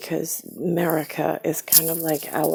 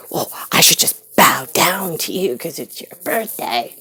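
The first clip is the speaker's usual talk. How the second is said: sarcastic mimicked mother; 5 dB louder than usual.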